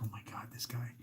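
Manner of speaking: breathy exasperated whisper. Retold or imitated. Reacting to his brother's joke, whispered. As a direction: whisper it under your breath. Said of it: "I'm like".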